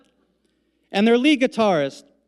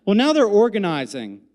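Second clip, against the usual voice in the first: ordinary delivery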